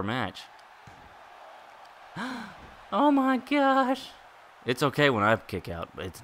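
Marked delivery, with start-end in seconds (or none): 2.1-2.6 sharp gasp. Real, not acted. Shocked by near-fall in game. 2.9-4.1 stunned disbelief. Real, not acted. Shocked by near-fall in game.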